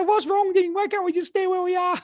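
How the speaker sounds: high squeaky parody of a worried wife; imitating his wife Claire. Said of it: "that's exactly how she sounds".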